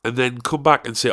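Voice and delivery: normal talking voice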